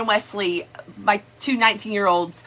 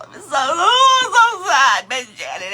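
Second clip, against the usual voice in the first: real crying; 4 semitones higher, 5 dB louder than usual. Real, not acted. Telling viewer Miss Janet about the dead lizard, crying. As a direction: voice cracking into a sob. Breaking down over the pet's death.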